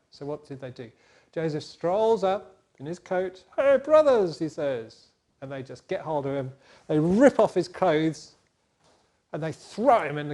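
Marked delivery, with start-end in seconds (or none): 3.6-4.4 loud, high cheery hail; bright greeting. Acted. Joseph greeting his brothers, loud and cheerful. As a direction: call out happily.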